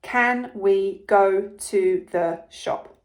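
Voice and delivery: "Can we go to the shop?" said very slowly, word by word; slow dictation. Shown as a demonstration. Teacher to pupils, dictating the sentence very slowly. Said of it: "The sentence is".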